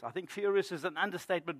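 ordinary delivery

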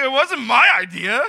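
loud, high mock-indignant objection; comic protest. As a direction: act out a husband objecting to his wife. Husband protests to his wife.

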